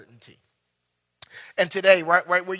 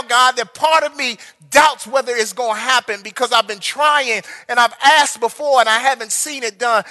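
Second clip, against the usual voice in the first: loud, higher pleading prayer to God; fervent shouted prayer; 4 semitones higher, 9 dB louder than usual. Acted. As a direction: cry out to God, confessing doubt.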